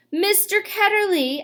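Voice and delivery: high, loud shout of sudden recognition. A narrator's reading. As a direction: blurt the name out in a shout. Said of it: "she shouted".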